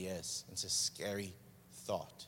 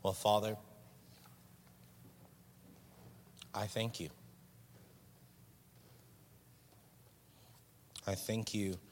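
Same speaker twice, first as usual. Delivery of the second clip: prayer. Acted. Leads prayer.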